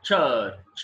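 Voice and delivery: "church" drawn out slowly with a long vowel and silent r; slow, falling demonstration of church; model pronunciation. Shown as a demonstration. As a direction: stretch church, leaving out the r. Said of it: "This is how we have to pronounce".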